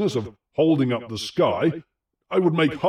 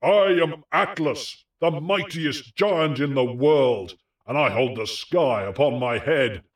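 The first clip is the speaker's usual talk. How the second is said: giant roaring out; 3 semitones higher than usual. A narrator's reading. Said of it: "he roared out to him anew".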